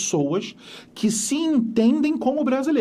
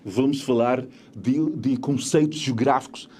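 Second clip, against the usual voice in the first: slow, lower European Portuguese accent; 5 semitones lower than usual. Shown as a demonstration. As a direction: sound like someone from Portugal. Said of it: "alguém que fala assim".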